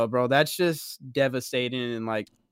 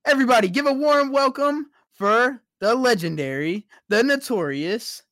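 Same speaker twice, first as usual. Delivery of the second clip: hype announcer; 7 semitones higher, 4 dB louder than usual. Acted. Introducing the special guest.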